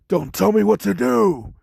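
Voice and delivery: high, loud snapped retort; angry shout. Retold or imitated. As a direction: bark it back at the rival, furious. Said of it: "Todoroki pissed off".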